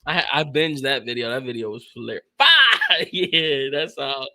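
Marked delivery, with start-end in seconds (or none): 2.4-3.1 high, loud imitated shout of "Five!" through a laugh; laughing reenactment of the meme's shout; mock shout. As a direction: bark the number like the meme. Replaying the meme line.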